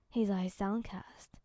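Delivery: usual speaking voice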